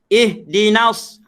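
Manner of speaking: "Ihdinas" slow with a throaty, vibrating h. Shown as a demonstration. Ustaz demonstrates the correct ha in "Ihdinas". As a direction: let the h in "Ihdinas" buzz in the throat. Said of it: "Bergetar kat sini".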